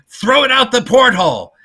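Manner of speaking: high, loud barked order; retold high, loud command; Bud's urgent order. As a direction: imitate Bud ordering it overboard. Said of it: "Bud says".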